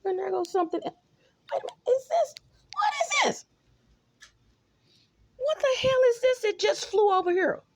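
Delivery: high, shrieking shout at a bug flying past; startled shriek. Real, not acted. Vlogger spotting a bug flying at her, shrieking in alarm. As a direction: squeal in real alarm at a flying insect.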